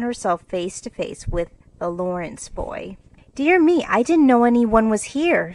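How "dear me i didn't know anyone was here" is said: startled and flustered, high and loud; flustered stammer. Acted. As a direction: blurt it out, caught off guard. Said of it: "stammered Jo".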